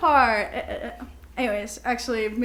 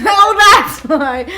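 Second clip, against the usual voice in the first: laughing; 6 semitones higher, 11 dB louder, much slower than usual. Real, not acted. Laughing with friend.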